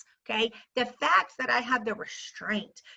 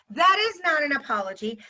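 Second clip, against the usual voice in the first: ordinary talk, nothing marked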